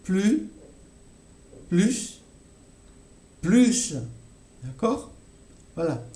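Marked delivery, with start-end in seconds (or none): 0.0-4.0 plus said three times, first without s, then with s; "plus" dropping then adding the s; repeated plus. Shown as a demonstration. Teacher repeating for students: the ways to say "plus". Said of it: "Je, je répète encore".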